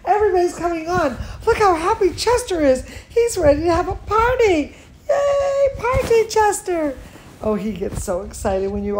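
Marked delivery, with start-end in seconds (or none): 0.1-7.0 high, loud, gleeful cheering; real excitement. Real, not acted. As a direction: cheer the party on, pitch climbing to a squeal on yay. Cheering on excited Chester as viewers join.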